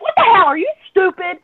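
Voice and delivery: high, loud incredulous yell; yelling at fiancé over a fake flat tire; retold yelling. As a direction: shriek at him in exasperation. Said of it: "And I'm yelling".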